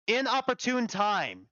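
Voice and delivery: loud exclamation. Acted. Mavor greeting a newly dead arrival, loudly exclaiming. Character voice, correcting a misread word.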